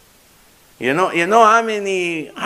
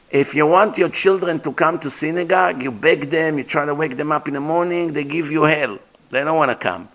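usual speaking voice

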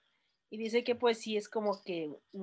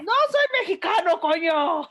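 much higher and louder, indignant yell; mock outraged shout; 9 semitones higher, 10 dB louder than usual. Friend retells his outburst at being called Mexican, with a loud indignant yell. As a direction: bark it back in protest. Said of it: "Y él".

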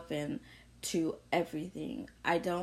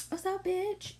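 high squealed greeting, much higher; playful greeting; 13 semitones higher than usual. Retold or imitated. Teasing family greeting with a high squeal. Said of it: "'cause we always like".

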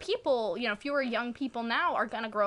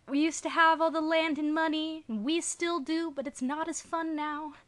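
higher, imitating [name]'s character; mock song quote; 6 semitones higher than usual. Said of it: "like that [name] song".

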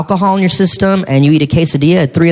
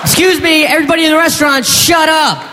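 announcing to the room; 10 semitones higher than usual. Pretend. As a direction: call out to everyone in the restaurant. Calling out to whole restaurant.

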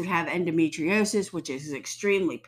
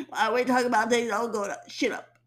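Cartman; 3 semitones higher than usual. Retold or imitated. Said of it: "feel like Cartman on South Park".

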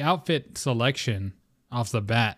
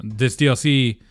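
usual speaking voice

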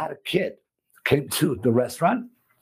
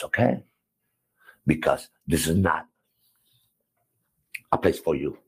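cold dismissal; 11 semitones lower, 3 dB quieter than usual. Retold or imitated. Owner dismissing the worker.

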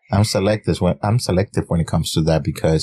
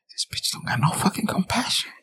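breathy mock whisper, higher and much softer; 11 semitones higher, 8 dB quieter than usual. Husband teasing his wife on the podcast, in a mock whisper. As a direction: hiss it like a secret aside.

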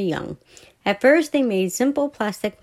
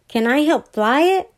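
put-on eager boy; 6 semitones higher than usual. A narrator's reading. Billy asks eagerly.

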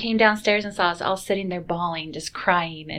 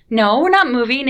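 louder, insistent no; firm denial; 4 semitones higher, 9 dB louder than usual. Retold or imitated. Family denying the rumor to church members, insistent. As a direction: shut the rumor down firmly.

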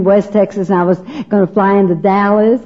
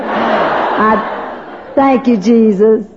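prayer; 4 semitones lower than usual. Acted. Speaker prays aloud over mothers and daughters. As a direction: pray sincerely over the room.

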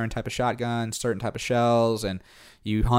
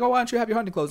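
curious local; 11 semitones higher, much faster than usual. Retold or imitated. Locals quizzing him at hunt.

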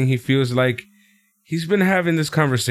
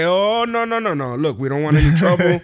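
nervous manager; 4 semitones higher than usual. Retold or imitated. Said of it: "Drake is like".

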